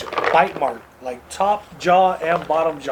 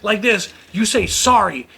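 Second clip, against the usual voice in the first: high-pitched indignant scolding; retold angry outburst; 6 semitones higher than usual. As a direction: scold the other parent, voice pitched up. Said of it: "That, like, set me off".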